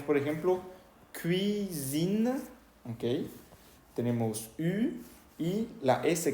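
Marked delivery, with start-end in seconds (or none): 1.2-2.3 "cuisine" slowly split as kɥi-zine, higher pitched; French U, voiced S. Shown as a demonstration. Teacher gives an example for U, French kɥi-zine. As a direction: draw out "cuisine" with a buzzed Z. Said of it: "para la U tenemos".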